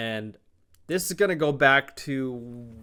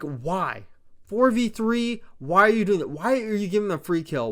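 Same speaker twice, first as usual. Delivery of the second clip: exasperated rant; 2 semitones higher, 5 dB louder than usual. Real, not acted. To the player, still furious and demanding why. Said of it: "I was so fucking furious watching this".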